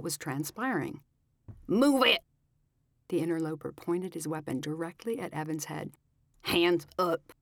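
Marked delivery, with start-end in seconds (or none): 1.7-2.2 loud, high barked order; shouted command. A narrator's reading. Kidnapper barks order. 6.4-7.3 gruff gunman command. A narrator's reading. Gunman orders groom.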